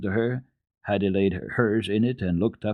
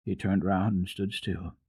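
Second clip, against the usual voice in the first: usual speaking voice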